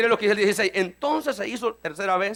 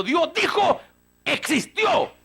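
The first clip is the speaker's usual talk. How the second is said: preaching exclaim; 8 semitones higher, much slower than usual. Acted.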